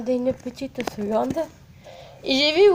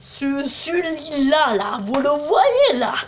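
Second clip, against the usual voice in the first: drawn out slowly; slow, showing off; 2 semitones higher, much slower than usual. Real, not acted. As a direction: linger over each word. Showing off purple trousers.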